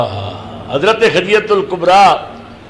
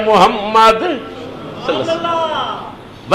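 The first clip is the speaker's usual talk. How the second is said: shy and respectful; 5 semitones higher, much slower than usual. A narrator's reading. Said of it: "شرم نال جھک کے ادب نال کیا". (شرم تے ادب نال، ہولی تے آہستہ)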